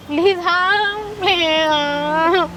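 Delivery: drawn-out wailing cry; sobbing plea. Acted. Daughter begging her mother to stay, crying. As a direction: plead through sobs, stretching the words.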